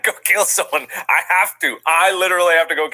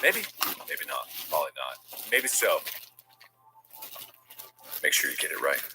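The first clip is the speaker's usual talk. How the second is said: quiet teasing; 12 semitones lower, 13 dB quieter, faster than usual. Acted. Hushed taunting.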